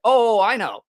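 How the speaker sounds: loud, high drawn-in reply to a friend; retold acknowledgment. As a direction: act it out big and high. Acting own sheepish reply.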